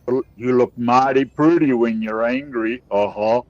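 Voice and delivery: slow Southern charmer in a mock American accent; American cowboy accent. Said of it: "American accent".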